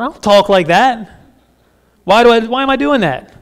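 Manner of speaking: acted bafflement at yourself; baffled self-questioning. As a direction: catch yourself and question it aloud. Acted self-questioning after pious prayer.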